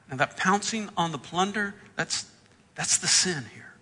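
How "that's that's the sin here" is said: normal talking voice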